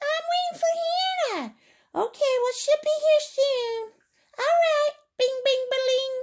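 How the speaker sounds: very high toy bicycle voice. Pretend. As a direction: talk as the little bike waiting.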